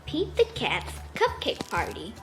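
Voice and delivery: usual speaking voice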